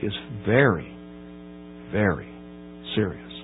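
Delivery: very, very serious sinks low and soft; solemn low warning; hushed gravity. Real, not acted. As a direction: let it fall to a grave near-hush. Solemn drop on the stakes.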